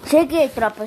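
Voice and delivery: loud, cheerful arrival call; loud greeting. Real, not acted. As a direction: announce yourself to the chat. Announcing return to stream.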